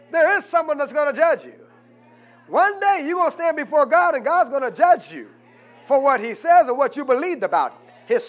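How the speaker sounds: loud, high fervent warning; preaching at full force. Acted. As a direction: shout the warning to the congregation.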